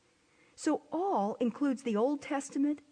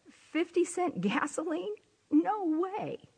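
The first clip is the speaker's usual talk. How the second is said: higher-pitched mock disbelief; incredulous exclamation; 7 semitones higher, slower than usual. Shown as a demonstration. Preacher giving her congregation an everyday example, mock incredulous. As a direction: react as if you can't believe the price.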